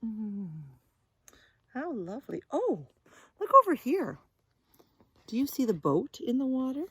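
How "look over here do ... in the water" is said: ordinary delivery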